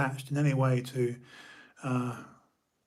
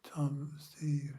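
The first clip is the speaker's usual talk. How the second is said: soft unvoiced whisper of names; whispered to himself; 7 dB quieter than usual. Real, not acted. Listing friends under his breath, whispered. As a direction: whisper the names as you count.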